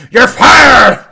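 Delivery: high, loud wrestling-boss shout; mock Vince McMahon "you're fired", very high and loud; Vince McMahon yell. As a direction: bellow the firing like a TV boss. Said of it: "Like Vince McMahon".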